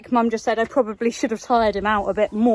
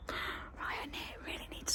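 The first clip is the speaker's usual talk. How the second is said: soft whisper, barely voiced; 18 dB quieter than usual. Real, not acted. Muttering to self about chores, whispering. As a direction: keep it hushed, under the breath. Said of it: "Why am I whispering?".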